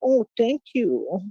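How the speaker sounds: high, slow, fawning mock gratitude; drawn-out sarcastic beg; mock grovelling thanks. Mocking donators who expect him to kiss up, simpering. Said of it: "get on my knees and be like".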